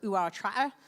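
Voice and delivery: mock West Country farmer burr; Gloucester accent. Shown as a demonstration. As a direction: put on a broad Gloucester accent. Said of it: "I was born in Gloucester".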